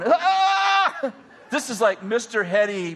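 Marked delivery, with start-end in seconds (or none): 0.0-1.1 high, loud cry of a man shaking on the floor; electrocuted yell. Retold or imitated. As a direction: let out a sudden high jolted yell. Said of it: "and he's going".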